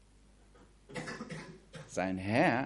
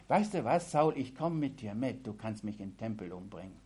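fading into a softer, quicker mumble; resigned despair; 5 semitones lower, 7 dB quieter, faster than usual. Retold or imitated. David tells Saul he gives up, resigned and fading. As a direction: give up, voice sinking away.

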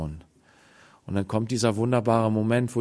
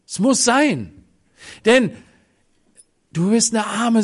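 inviting call; 9 semitones higher, 6 dB louder than usual. Acted. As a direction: call him down with eager love. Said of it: "Er sagt".

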